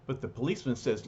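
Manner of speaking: ordinary delivery, nothing marked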